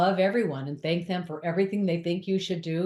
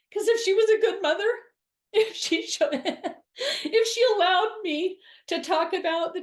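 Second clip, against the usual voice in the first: laughing; 10 semitones higher than usual. Real, not acted. Laughing while recounting mother.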